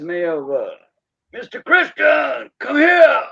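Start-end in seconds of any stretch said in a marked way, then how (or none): 1.3-3.2 high, bellowing captain's shout; Charles Laughton impression. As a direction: roar the order at the crew. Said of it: "Red Fox did that one time. That was Charles Lawton".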